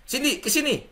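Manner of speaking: high-pitched, commanding call; abrupt summons. Shown as a demonstration. Older brother orders his sister over, abrupt high-pitched summons. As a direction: summon a younger sibling bluntly. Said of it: "Ini cara pertama".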